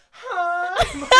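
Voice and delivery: loud high-pitched greeting through the wall. Retold or imitated. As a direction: shout a squeaky hi. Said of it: "She just goes".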